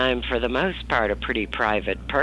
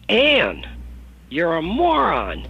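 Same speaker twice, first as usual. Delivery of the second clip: mother scolding; 6 semitones higher, slower than usual. Retold or imitated. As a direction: snap at her daughter from the passenger seat. Mother berating daughter while driving.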